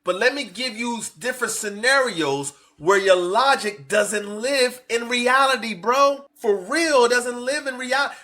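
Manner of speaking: lightly voiced woman firmly correcting him; voicing her retort. Commentator voicing her reply to the host, emphatic. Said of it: "she answered their question with".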